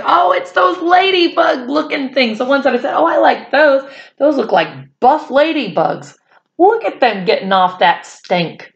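high, eager exclaiming; thrilled at the buff ladybugs; excited. Retold or imitated. Excited kid's retold remark.

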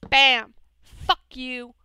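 very loud strike cry then curse; triumphant yell. Real, not acted. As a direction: shout as you land the blow. Striking the zombie.

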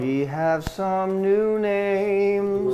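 slow, stretched-out announcement; drawn-out grand introduction; show announcer. Pretend. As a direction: address the crowd like an emcee. Host announces new donor names.

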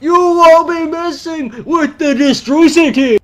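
loud, slow, high wrestler promo; Ultimate Warrior impression. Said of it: "Hulk, the Warrior impression".

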